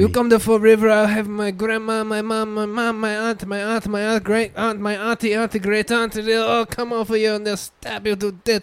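mock Portuguese threat, listing aunts; fake Portuguese accent. Warning visitors to Fall River in a fake Portuguese accent. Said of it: "racially ambiguous fucking accent".

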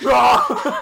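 loud, high "rah"; explosive mock roar. Escalating meme of ever whiter paint, mock roar. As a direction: burst out roaring, half laughing. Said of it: "he's gonna be like".

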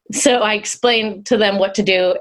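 normal talking voice

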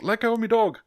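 high-pitched mock warning; man confronting a kangaroo. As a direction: play an indignant dog owner charging in. Mimics man rushing at kangaroo.

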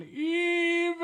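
high, loud cartoon hero cry; Mermaid Man. Mermaid Man spotting villainy, high cartoon cry. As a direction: bellow it like an old cartoon superhero. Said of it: "SpongeBob Mermaid Man".

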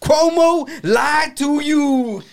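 slow, high, ranting [name]; a hint of a preaching [name]. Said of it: "I feel like [name]".

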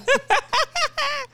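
high, loud burst of laughter; big laugh. Real, not acted. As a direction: crack up completely. Laughing at her own retort.

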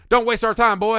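loud mocking teenager; mock tough shout. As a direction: bark it like a bully. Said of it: "be like".

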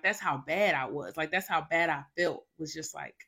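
normal talking voice

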